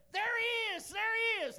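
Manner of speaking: high, loud excited calling out; excited shout. Retold or imitated. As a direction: shout it across the room, pointing. Woman shouting in restaurant.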